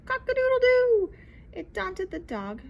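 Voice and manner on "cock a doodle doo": high loud crow; rooster crow. Acted.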